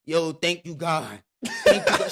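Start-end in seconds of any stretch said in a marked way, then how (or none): 1.4-2.1 much higher and louder, laughing; overjoyed outburst, half laughing; hammed-up joyful cry. Retelling his joyful thanks at the hotel, hammed-up and loud. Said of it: "And I just bust out like".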